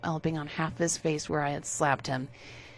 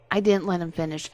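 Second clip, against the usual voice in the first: ordinary delivery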